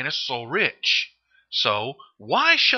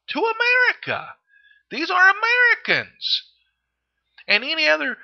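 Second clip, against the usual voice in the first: outraged; 14 semitones higher, 4 dB louder than usual. Real, not acted. Pitch spikes in angry plea.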